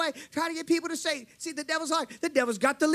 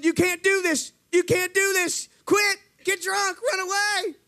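raised, higher retold yelling; insistent mock screaming, pitched up; screaming voices; 6 semitones higher, slower than usual. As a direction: imitate tormenting voices hurling commands. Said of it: "all these voices screaming at me".

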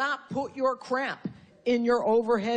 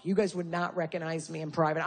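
ordinary talk, nothing marked

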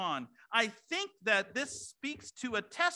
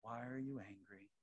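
gentle question; 12 semitones lower, 15 dB quieter than usual. Retold or imitated. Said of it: "And I would say".

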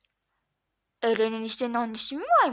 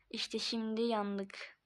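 doomed mutter; 4 semitones lower, 10 dB quieter, much slower than usual. Acted. Dread after laughing.

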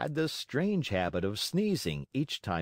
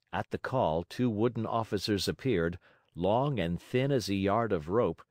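ordinary delivery

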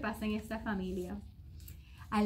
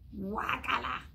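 disgust; slower than usual. A narrator's reading. Child eating disliked candy.

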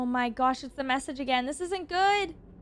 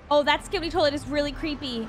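normal talking voice

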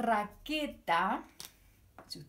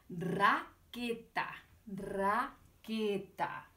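Raqueta stretched out very slowly; teacher modelling the word raqueta for children, slow; 3 semitones lower, much slower than usual. Shown as a demonstration. Raqueta drawn out slowly. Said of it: "modelling the word".

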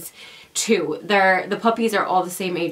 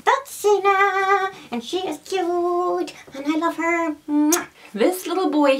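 high cooing voice to a pet; 11 semitones higher than usual. Real, not acted. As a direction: coo at the puppy, much higher. Cooing baby voice to dog.

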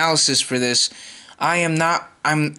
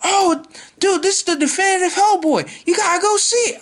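much higher, laughing bro voice; hyped fan; 12 semitones higher than usual. Retold or imitated. Vlogger imitating a fan bro raving about Hellboy, much higher. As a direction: squeal like an overexcited dude. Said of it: "Then they'll be like".

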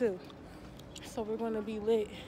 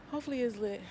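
ordinary talk, nothing marked

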